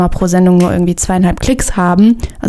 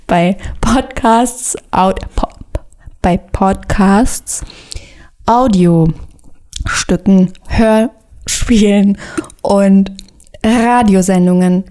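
slow, drawn out; much slower than usual. Acted. Drawn out, whispered.

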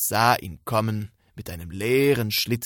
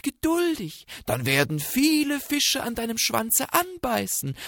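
sly coaxing fox; 10 semitones higher, 5 dB louder than usual. Acted.

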